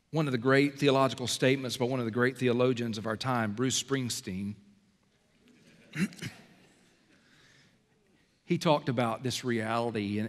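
usual speaking voice

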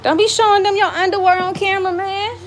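high, loud call across the yard; high scolding call. Real, not acted. Mom calls out to kids undressing on camera, high loud scold.

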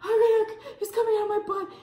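high-pitched laughing mock yell. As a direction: squeal it high, half laughing. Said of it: "I was like, yelling".

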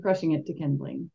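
plain reading voice

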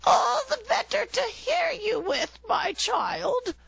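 very high, strained fake granny voice; the wolf in bed faking granny's voice. A narrator's reading. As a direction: squeak like a wolf pretending to be granny.

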